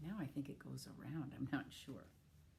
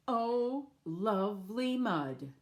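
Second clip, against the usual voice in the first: a much louder, higher joyful shout; 6 semitones higher, 9 dB louder, slower than usual. Shown as a demonstration. Teacher leading children to shout the animals' line, loud. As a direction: shout it out with the kids. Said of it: "we can shout this".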